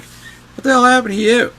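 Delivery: high, loud disbelief; stunned buddy. Retold or imitated. Pissed-off friend hears him play, loud shocked disbelief. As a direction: blurt it like your jaw just dropped. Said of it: "He's like".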